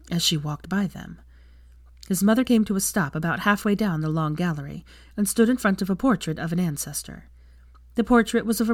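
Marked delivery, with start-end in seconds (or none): none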